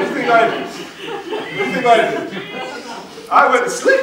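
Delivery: loud and high, laughing through the words; laughing punchline. Real, not acted. Speaker to audience, a loud laughing setup and punchline. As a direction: throw the punchline out with a big laugh.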